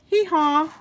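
high braying hee-haw; donkey bray. Acted. Reader brays for the donkey child.

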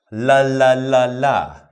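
crisp "la" syllables with the tongue flicking forward; tongue-flick la drill. Shown as a demonstration. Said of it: "Now we're doing this one".

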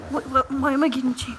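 usual speaking voice

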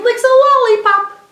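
high, loud punch on the lollipop line; playful. Shown as a demonstration. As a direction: lift it high and loud for little kids. Playful alliterative letter line.

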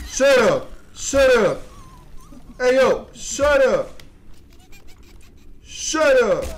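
loud, high yells over and over; annoyed shouted commands; repeated yelling. Real, not acted. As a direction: keep barking shut up at them. Shouting at noisy critters.

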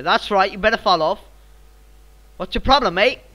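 loud, high angry Australian challenge. Pretend. Gamer telling a rival to back off, angry Australian accent. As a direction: warn them off like a riled-up Australian. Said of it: "I sound like an angry Australian".

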